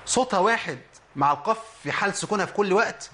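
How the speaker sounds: normal talking voice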